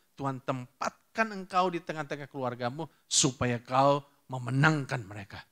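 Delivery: ordinary delivery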